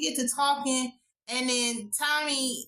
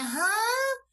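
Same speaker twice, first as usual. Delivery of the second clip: high, drawn-out baffled huh; mock bafflement; 10 semitones higher than usual. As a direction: imitate a puzzled reaction, pitched way up. Acts out wife's baffled look.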